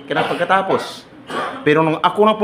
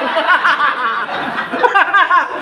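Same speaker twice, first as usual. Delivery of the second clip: long high cackle; high laughter; 14 semitones higher, 3 dB louder than usual. Real, not acted. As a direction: laugh hard at the crowd. Laugh at joke. (mataas na halakhak)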